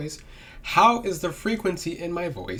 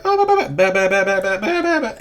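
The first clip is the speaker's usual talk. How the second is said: high, louder babbling mock liar; imitating a liar; 5 semitones higher, 4 dB louder than usual. Vlogger mimicking a lying person's jumpy babble, high and loud. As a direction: babble like someone lying, pitched up. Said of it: "people who are lying".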